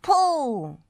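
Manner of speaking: high, loud command; straining cry; urgent shout. Acted. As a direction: call out to pull. Straining to pull Dizzy out.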